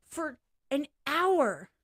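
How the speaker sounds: high, slow put-on cartoon voice; a goofy put-on whine; funny voice. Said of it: "What voice did I do?".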